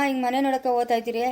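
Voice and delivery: usual speaking voice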